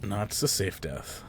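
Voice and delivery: usual speaking voice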